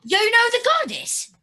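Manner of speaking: high, loud surprised question. Real, not acted. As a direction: react with sudden surprise. Surprised, +10 semitones louder.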